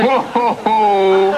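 goofy honking laugh; silly clown. Acted. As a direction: guffaw like a clown. [name] laughs at painting.

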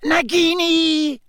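very high, slow, chilling call; drawn-out high villain coo; cold voice. A narrator's reading. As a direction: summon the snake in an icy high voice. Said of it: "said the cold voice".

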